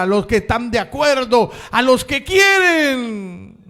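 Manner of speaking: loud, high, impassioned sermon; shouting preacher building to a peak; fiery preaching. Acted. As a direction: preach it out at full force. Preaching at full force.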